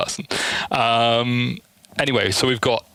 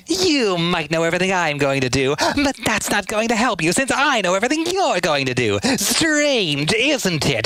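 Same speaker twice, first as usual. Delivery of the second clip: put-on much higher, smug kid voice; OVA Tails voice; 9 semitones higher than usual. Kid fox taunting a rival, high and smug. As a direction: taunt a rival with cocky confidence. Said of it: "O V A".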